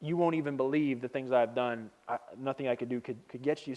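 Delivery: low, quiet, dismissive; unimpressed refusal. Retold or imitated. Jesus refusing the sign seekers, low and weary. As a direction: turn them down softly, voice dropped low. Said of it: "he doesn't react well to it".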